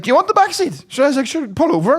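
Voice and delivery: high-pitched mock-obliging question; retold over-eager offer. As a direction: shoot the pitch up as you offer.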